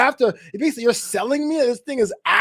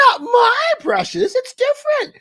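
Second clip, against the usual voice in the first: doting dog owner; 6 semitones higher, 4 dB louder than usual. Retold or imitated. Everyone insisting their own dog is different, high gushing coo. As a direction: coo like an owner defending her pet. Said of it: "everyone goes".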